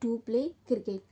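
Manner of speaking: very slow, word by word; slow dictation. Shown as a demonstration. Teacher dictating the translation slowly to her students. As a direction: give it slowly so they can write it down. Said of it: "ठीक है बच्चों".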